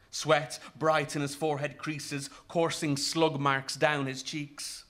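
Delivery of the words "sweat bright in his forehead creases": ordinary delivery, nothing marked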